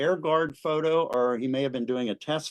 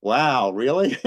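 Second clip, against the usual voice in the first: loud, drawn-out disbelief breaking into a laugh; amazed reaction; 5 dB louder, slower than usual. Retold or imitated. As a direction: react in amused astonishment. Reacting to the pilot's calm account.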